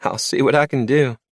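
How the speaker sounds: April cutting in, high and bright-voiced; bartender April. A narrator's reading. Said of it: "The bartender chuckled".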